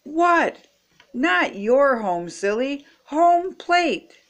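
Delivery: high-pitched, drawn-out storybook shouting; exasperated calling out. A narrator's reading. Frogalina correcting Froggy running the wrong way, exasperated yell. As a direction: set him straight from across the field.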